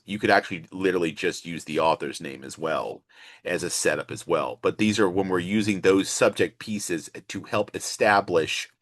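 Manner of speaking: ordinary talk, nothing marked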